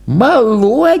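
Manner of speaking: lightly voiced pitying old woman. A narrator's reading. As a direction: coo it with pity like an old woman. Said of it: "сказала старуха".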